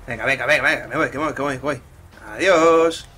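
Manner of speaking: loud panicked escape; excited shouting. Real, not acted. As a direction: shout as you run off. Fleeing police in game.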